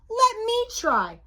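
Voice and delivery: high-pitched eager challenge; cocky storybook mummy; boastful challenge. A narrator's reading. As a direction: step up boldly in a high voice. Boasting mummy takes her turn.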